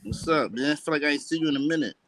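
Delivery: ordinary delivery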